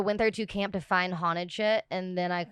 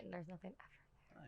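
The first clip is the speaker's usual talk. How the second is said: whispering; 24 dB quieter than usual. Real, not acted. Ghost story told in whisper.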